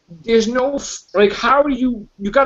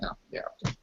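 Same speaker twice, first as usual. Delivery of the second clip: breathy disappointed whisper; whispered letdown; 18 dB quieter, much faster than usual. Retold or imitated. Reacting to the movie's turn, disappointed whisper. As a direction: mutter it, let down by the twist. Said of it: "and I'm like".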